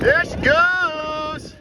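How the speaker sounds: high, long-drawn shout; excited cheer. Real, not acted. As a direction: stretch it out as your teammate takes off. Cheering teammate on.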